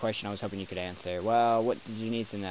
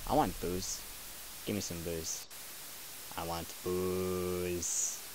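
put-on drunk mumble; 5 semitones lower, 13 dB quieter, slower than usual. Drunk character begging for booze.

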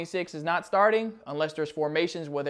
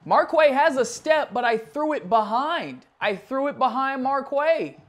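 frustrated; 9 semitones higher, 5 dB louder than usual. Real, not acted. Frustrated replay review.

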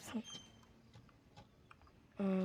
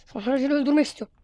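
fast, loud and higher venting; angry rant; 5 semitones higher, 10 dB louder, faster than usual. Real, not acted. Angry gamer venting after dying, fast furious rant. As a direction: blurt it out in a rage. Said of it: "Çok sinirlendim".

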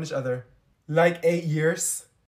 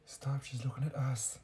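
whispering; 5 semitones lower, 14 dB quieter than usual. Acted. Embarrassed, hiding from girl staring.

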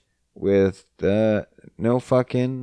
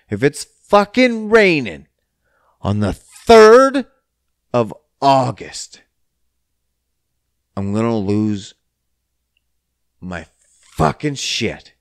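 exasperated warning; 5 dB louder than usual. Real, not acted. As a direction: rant it like a threat to the weather. Ranting threat about rain on his date.

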